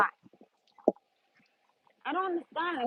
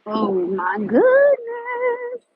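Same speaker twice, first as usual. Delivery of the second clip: drawn-out freezing cry; 7 semitones higher, much slower than usual. Real, not acted.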